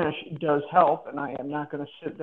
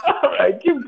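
laughing; 6 semitones higher, 7 dB louder than usual. Real, not acted. Laughing after mother joke.

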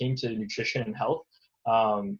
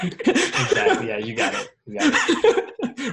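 laughing; 4 dB louder, slower than usual. Real, not acted. Laughing agreement with host's joke.

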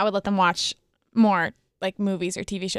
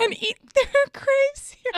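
high laughing shout; 3 dB louder than usual. Real, not acted. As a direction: blurt it out through laughter.

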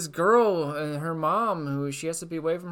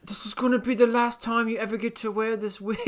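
mock interviewer; 8 semitones higher than usual. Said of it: "mock interviewer quote".

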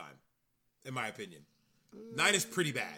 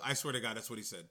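ordinary talk

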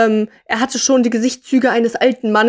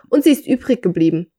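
ordinary delivery

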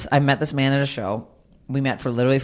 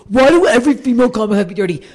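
high, loud mocking whine of a guy; mocking men; 10 semitones higher, 10 dB louder, faster than usual. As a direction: parody the complaining guy. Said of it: "guys are like".

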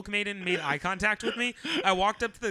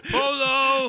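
high loud Marco Polo call; 7 semitones higher, 10 dB louder than usual. Pretend. Friend answering a name like a pool game, calling out. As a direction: sing out Polo across the pool.

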